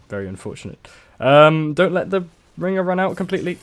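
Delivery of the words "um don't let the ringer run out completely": urgent, higher and louder warning; alarmed warning. Real, not acted. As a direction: call out the danger quickly. Reacting to gameplay mistake.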